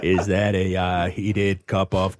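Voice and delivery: hammed-up Boston Kennedy accent; broad, over-the-top Boston vowels like Mayor Quimby. Friend quoting Cyclops's line in an over-the-top Boston accent. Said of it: "I love that accent".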